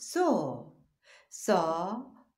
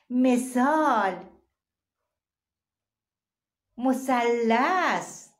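ثلث stretched very slowly and much louder; slow syllable reading; 2 semitones higher, 7 dB louder, much slower than usual. Shown as a demonstration. Teacher sounds out ثلث slowly and loudly for children. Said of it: "نگاه کنید بخش و صداشو".

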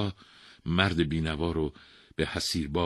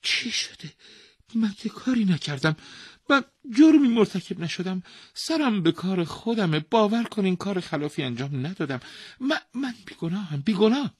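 high, panicky protest; terrified mat weaver protesting his innocence; frightened and worried; 14 semitones higher, 7 dB louder than usual. Acted. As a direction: gasp in fear and deny everything. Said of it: "با وحشت و نگرانی گفت".

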